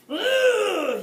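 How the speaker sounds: loud high yell of frustration; frustrated yell. A narrator's reading. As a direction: let out a furious ugh. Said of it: "he yelled in frustration".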